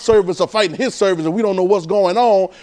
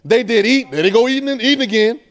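preaching; 4 semitones higher than usual. Acted. Pastor challenging congregation about buying, fervent. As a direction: drive it at the crowd.

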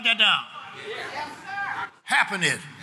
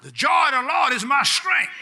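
proclaiming; 7 semitones higher, 4 dB louder than usual. Real, not acted. Preacher proclaims scripture to congregation.

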